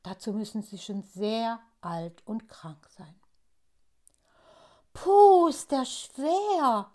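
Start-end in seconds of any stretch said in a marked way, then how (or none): 4.9-6.9 strained, slow groan; groaning under strain. A narrator's reading. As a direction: groan under a heavy load. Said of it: "stöhnt Pinsel".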